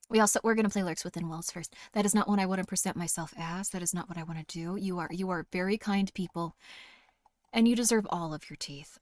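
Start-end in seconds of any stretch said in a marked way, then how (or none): none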